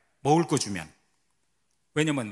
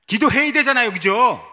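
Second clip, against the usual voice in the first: loud push; 6 semitones higher, 10 dB louder than usual. Real, not acted. Preacher pressing the congregation.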